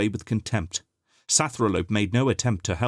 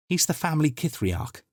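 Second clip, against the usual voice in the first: put-on lightly raised young voice; a hint of an eager young cousin; young Arcue explaining; Arcue's voice; 8 semitones higher than usual. A narrator's reading. Arcue's higher character voice.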